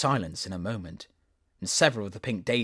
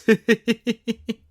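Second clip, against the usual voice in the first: shrill giggle; 13 semitones higher, 11 dB louder than usual. Acted. Daisies laugh.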